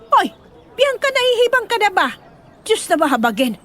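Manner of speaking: high-pitched shocked outburst; scandalized disbelief, voice jumping up. Acted. Yaya aghast at Bianca's plan to marry at the fiesta, shocked high outburst.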